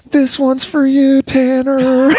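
high, slow, loud surfer shout-out; surfer turtle voice. Retold or imitated. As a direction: dedicate it grandly like a surfer turtle. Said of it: "the guy's all".

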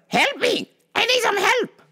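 high-pitched mocking customer voice; demanding customer. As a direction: screech it like an entitled shopper. Impersonates customer demanding help.

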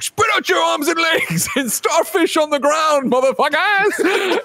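high, strained, drawn-out vigilante yell; hammed-up crime-fighter ordering crooks down; mock commanding shout. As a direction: shout it slowly in an over-the-top voice. Bruce barking orders at crooks.

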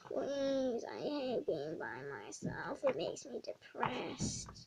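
low, soft, gloomy plea; mopey sad kid. Acted. Gloomy student pleading with the teacher, low and soft. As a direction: sink into the gloom and mumble it.

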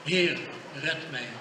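usual speaking voice

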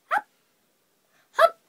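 single sharp hiccups with gaps; hiccup, pause, hiccup; short, sudden mock hiccups; acted hiccups. A narrator's reading. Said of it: "I got the hiccups".

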